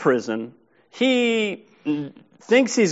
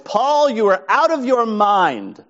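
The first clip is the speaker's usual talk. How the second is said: slow, raised, higher outburst at Paul; loud exclamation; 5 semitones higher, slower than usual. Retold or imitated. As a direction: call him out loud and slow, governor to prisoner. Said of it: "he says in a loud voice".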